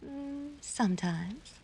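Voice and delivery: breathy teasing whisper, slow; flirty whisper. Acted. Dixie whispers coyly to Diamond across the tent, slow whisper. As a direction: tease him in a hushed whisper.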